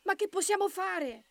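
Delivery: high, anxious little rabbit; high-voiced worry; very worried rabbit. A narrator's reading. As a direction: ask helplessly, pitched well up. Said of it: "Pepe era preoccupatissimo".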